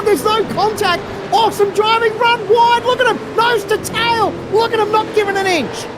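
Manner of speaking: high, rising race call, building fast; excited call. Real, not acted. Live battle for fourth, rising call.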